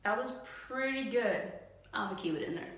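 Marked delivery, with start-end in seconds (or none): none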